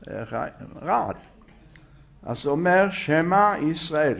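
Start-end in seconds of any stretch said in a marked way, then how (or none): 2.9-4.2 שמע drawn out as SHE-MA, then ישראל as YIS-RA-EL; slow, careful שמע with a sounded E; careful voiced sheva. Shown as a demonstration. Rabbi asking students to notice, slow careful SHE-MA. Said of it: "שמת לב".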